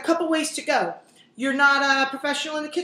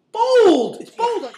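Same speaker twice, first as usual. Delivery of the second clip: bold punched much higher and louder; hammed-up proclamation of bold; exaggerated emphasis; 9 semitones higher, 9 dB louder, much slower than usual. Praising onion's strength.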